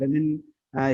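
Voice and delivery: usual speaking voice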